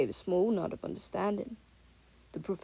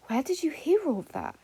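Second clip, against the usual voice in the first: higher, alarmed sudden question; a jolt of disbelief at what he's heard; shocked; 8 semitones higher, 3 dB louder than usual. A narrator's reading. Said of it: "Ferrex looked shocked".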